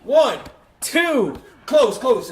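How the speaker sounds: loud, tense pin count; excited count. Real, not acted. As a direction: count along breathlessly. Counting the pinfall live.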